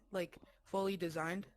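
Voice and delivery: ordinary delivery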